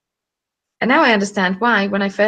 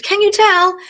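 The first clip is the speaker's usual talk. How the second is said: much higher, louder question; high excited ask; 13 semitones higher, 6 dB louder than usual. Real, not acted. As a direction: bubble over.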